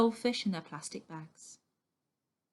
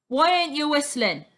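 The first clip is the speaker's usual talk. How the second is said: higher, louder sneering snarl; 6 semitones higher, 7 dB louder than usual. A narrator's reading. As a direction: throw it at him like a challenge. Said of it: "snarls the boorman".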